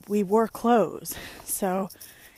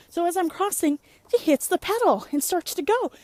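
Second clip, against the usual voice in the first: higher and louder, breathless storytelling; excited retelling; 6 semitones higher, 5 dB louder than usual. Retold or imitated. Woman telling how an SUV nearly hit her, excited. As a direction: relive the scare at full tilt.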